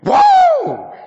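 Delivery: very high loud whoa; imitating Andy's whoa. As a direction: belt it like an overjoyed friend. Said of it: "Andy would walk in here like".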